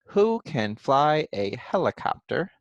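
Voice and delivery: slow, word-by-word reading of the question; reading back. Shown as a demonstration. Teacher reads back her written question, word by word. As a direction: point at each word as you read it aloud.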